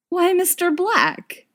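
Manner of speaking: high, slow, teasing feigned innocence; sly, drawn-out flirtatious tease; sly mock surprise. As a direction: play coy, as if it just occurred to you. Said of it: "as if it's only just occurred to him".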